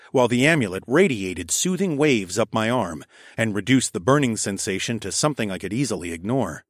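ordinary delivery, nothing marked

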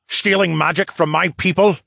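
bellowed outrage. A narrator's reading. Phineas challenges the merman stealing magic, bellowing. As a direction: raise the voice in outrage. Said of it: "Phineas bellowed".

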